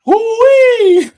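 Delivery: loud high whoop to open the show; excited whoop. Real, not acted. Host whooping at the start of the show, loud and high. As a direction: let out a big excited holler.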